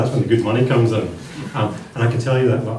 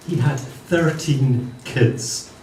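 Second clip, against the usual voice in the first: very slow, each word spaced; slow it right down for effect; slow, emphatic delivery; much slower than usual. Acted.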